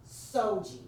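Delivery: normal talking voice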